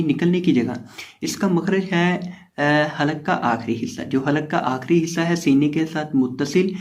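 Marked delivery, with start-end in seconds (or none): none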